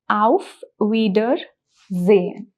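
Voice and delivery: Auf Wiedersehen drawn out slowly, every sound clear; slow demo. Shown as a demonstration. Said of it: "the first way in which you can say goodbye is".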